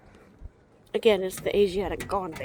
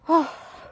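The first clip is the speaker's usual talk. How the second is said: heavy sigh; 7 semitones higher, 5 dB louder than usual. Real, not acted. Tired, back hurts.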